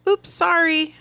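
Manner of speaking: high, breezy "Oops, sorry"; flippant. Retold or imitated. Speaker mocking a careless apology, high and flippant. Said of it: "this flippant thing".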